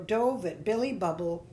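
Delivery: ordinary delivery, nothing marked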